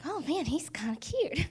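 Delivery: a hushed, giddy aside; whisper. Retold or imitated. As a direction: whisper it like a secret crush. Gushing over a boy, whispered.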